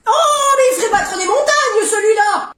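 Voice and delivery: high, drawn-out angry shout; slow, high-pitched cry of outrage; exasperated outburst. Acted. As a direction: explode at the troublemaking child. Mother furious at tattling son.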